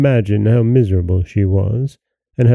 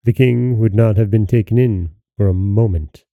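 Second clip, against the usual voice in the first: plain reading voice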